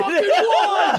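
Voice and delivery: high laughing mock yell; laughing echo. Cohost echoing the yell, high and laughing.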